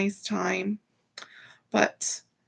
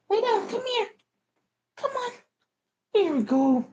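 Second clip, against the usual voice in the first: pet talk; 11 semitones higher than usual. Real, not acted. Settling the pet.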